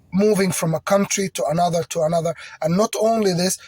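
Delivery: ordinary talk, nothing marked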